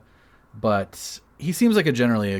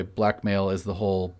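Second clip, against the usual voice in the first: ordinary delivery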